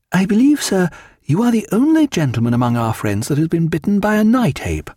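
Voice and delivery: high-pitched, animated society lady; Diana. A narrator's reading. Wife welcoming Mister Martin, high and animated. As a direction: lift the voice high, lively welcome. Diana voiced, greeting the guest.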